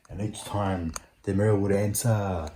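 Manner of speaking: ordinary delivery, nothing marked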